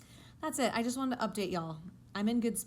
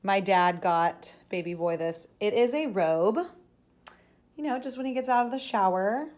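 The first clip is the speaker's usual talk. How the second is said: ordinary talk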